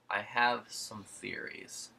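slow, drawn-out knowing voice; knowing detective voice. Retold or imitated. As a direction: savor each word like a sly detective. Arosio quoted on the phone.

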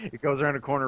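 ordinary talk

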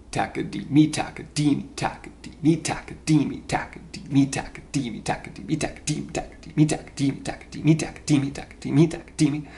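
"takadimi" with shifting syllables punched in a groove; offbeat accents. Shown as a demonstration. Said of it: "they'll put the accent on, on, like, an offbeat".

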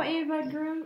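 usual speaking voice

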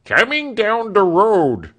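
loud high call from a servant; crying out. Acted. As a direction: call the coach arriving across the yard. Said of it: "cried Britain".

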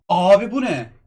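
startled shout at the screen; shocked outburst. Real, not acted. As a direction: yell it in sudden astonishment. Sudden shock at the game screen.

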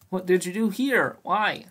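high, loud slow exasperated yell; raging gamer. Real, not acted. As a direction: demand it in disbelief.

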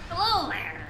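a light gentleman's greeting; Tails voice. Retold or imitated. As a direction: greet like a game character. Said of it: "in the, uh, Tails voice".

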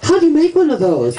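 high-pitched mock server question; imitated counter girl. McDonald's girl asking about the order, high imitated voice. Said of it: "the girl behind this counter is going".

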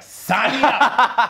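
high, loud, stretched-out eager cry; eager yes. Retold or imitated. Retelling accepting the paid gig, hammed-up eager shout. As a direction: jump at the five hundred dollars. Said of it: "And I was like".

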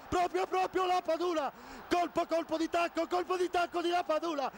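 fast, shouting goal call, rapid chant; breathless italian goal commentary. Real, not acted. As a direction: scream the goal and chant the name. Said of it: "breathless goal commentary".

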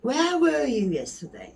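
"were" leaned on in "Where were you yesterday?"; stressed were. Shown as a demonstration. Teacher repeating the accusing question. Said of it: "Hesap soruyor, kızgın".

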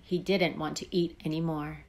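usual speaking voice

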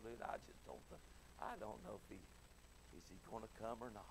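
doubtful whisper. Pretend. Voicing doubtful believers.

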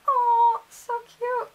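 high, melting "aww"; reenacted adoring squeak; happy cooing. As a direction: coo it like a swooning fangirl. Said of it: "like, so happy".

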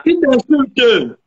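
high, drawn-out protest; real indignant cry, very slow and high; indignant outburst. Real, not acted. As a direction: stretch it out in high-pitched indignation. Indignant denial of insulting partner.

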